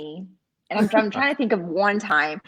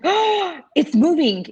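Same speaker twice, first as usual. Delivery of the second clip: put-on excited kid; 8 semitones higher, 6 dB louder, slower than usual. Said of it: "he's like".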